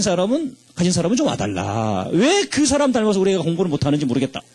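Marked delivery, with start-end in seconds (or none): none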